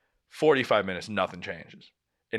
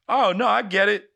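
sarcastic; 6 semitones higher, 4 dB louder than usual. Retold or imitated. Mocking what he'd say.

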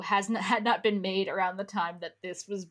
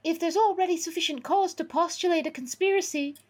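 high, put-on lecturing voice, a laugh breaking in; Harry; 11 semitones higher than usual. Harry reasoning out a conspiracy, high character voice. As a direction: play a high-voiced little theorist, nearly cracking up.

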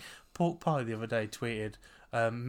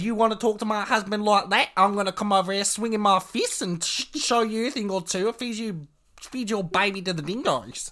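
mock tough woman; 8 semitones higher, 6 dB louder than usual. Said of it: "She said".

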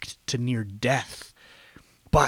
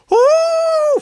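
high loud howl; wolf howl; 7 dB louder than usual. Acted. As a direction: howl like a pack of wolves. Collective earwolf howl.

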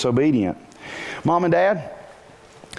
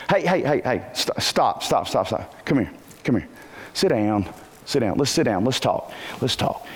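put-on softer and lower, gently settling a child down; calming; 5 semitones lower, 4 dB quieter than usual. Shown as a demonstration. Parent calming an agitated child instead of yelling. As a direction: ease the tension, quieter each time. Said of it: "What if you said this?".

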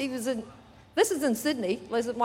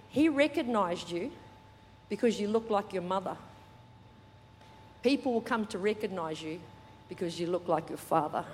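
lower, softer, unhurried with pauses; gentle voice of God; 4 semitones lower, 5 dB quieter than usual. Retold or imitated. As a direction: deliver it as a quiet, tender revelation. Voices God's gentle words to them.

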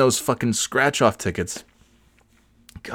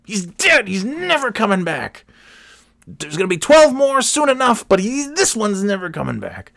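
very high, squealing victory cry; mock triumph; 12 semitones higher than usual. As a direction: celebrate a tiny kill like a huge win. 'cause you feel like.

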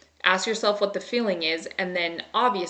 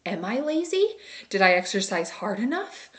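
self-questioning. Retold or imitated. Wife questioning herself after her husband asks about exercise, self-doubting. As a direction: act out doubting yourself, voice lifted at the start. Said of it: "I start to question".

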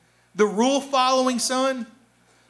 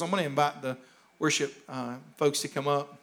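quiet aside; 9 semitones lower, 7 dB quieter than usual. Real, not acted. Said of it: "aside to worship team, off-mic".

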